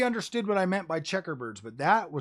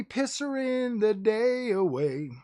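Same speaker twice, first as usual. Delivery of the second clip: higher, stretched-out goofy delivery; playful drawn-out catchphrase; 4 semitones higher, slower than usual. Pretend. Streamer teasing viewers about tomorrow's gaming, playful drawn-out catchphrase. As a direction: stretch the silly phrase out high.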